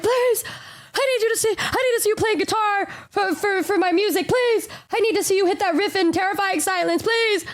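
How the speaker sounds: high, pleading begging; mock pleading. To Daryl, begging her to play guitar on tour, high pleading.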